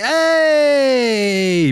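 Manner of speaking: high hammed-up hey; sarcastic little cheer; mock cheer. Fans mock-cheer Simmons again, high mock cheer.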